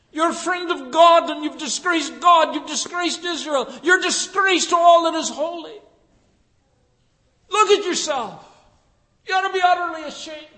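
high, loud accusing rant; guns a-blazing. Pretend. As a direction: tear into the king, full of scorn. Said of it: "with all his guns a-blazing".

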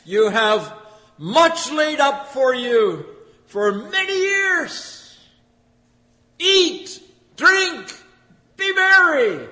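very high, slow, loud self-satisfied crowing; a farmer gloating in his rocking chair; gloating rich farmer. Acted. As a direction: savour every word, smug and drawn out. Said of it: "I'm going to say to myself".

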